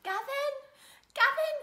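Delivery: high, loud call of a name. Real, not acted. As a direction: call Gavin across the room. Calling out to someone.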